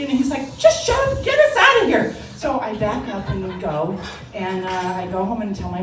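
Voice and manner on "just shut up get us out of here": very high, loud snapping shout; frantic yell. Retold or imitated. Instructor to hysterical student after the crash, frantic yell. Choking instructor yells at teen.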